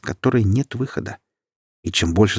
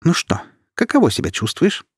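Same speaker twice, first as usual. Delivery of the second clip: tossed taunt; 9 semitones higher than usual. A narrator's reading. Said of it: "бросила девушка".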